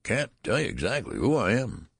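put-on high, whining old man; querulous whine. A narrator's reading. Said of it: "replied the querulous whine".

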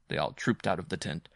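usual speaking voice

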